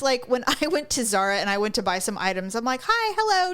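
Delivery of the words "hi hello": high, bright sing-out hello; chirpy greeting. Retold or imitated. Customer greeting the cashier at Zara, chirpy and high. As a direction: re-enact an over-friendly customer.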